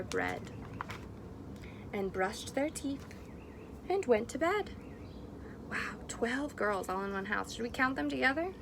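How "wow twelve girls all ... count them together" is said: ordinary delivery, nothing marked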